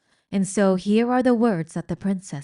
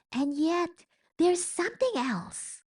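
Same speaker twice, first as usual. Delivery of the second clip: much higher, slow, wondering voice; wondering rat; 10 semitones higher, 3 dB quieter than usual. Acted. Roscuro smelling something else. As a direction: sniff out something lost.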